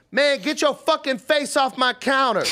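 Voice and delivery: high, loud mock yell; retold yelling. The cook yells at a man leaning on the glass, high loud mock yell. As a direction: bark at him to back off. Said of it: "And the lady goes".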